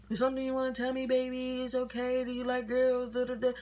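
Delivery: high, even-pitched mock coaxing; coaxing mother. Mom carefully asking her child, imitated coaxing. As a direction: imitate a mother gently easing into a question. Gentler wording mom could have used.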